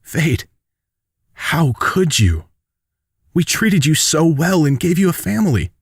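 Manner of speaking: high-pitched, wounded accusation; hurt reproach. Acted. Wounded daughter confronts the traitor, hurt reproach. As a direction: plead with someone who betrayed you. Betrayed heroine confronts her attacker.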